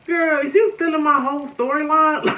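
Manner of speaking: high-pitched, incredulous retort; mock disbelief. Student challenging the sock thief's granny excuse, in high mock disbelief. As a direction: shoot up high in exaggerated disbelief.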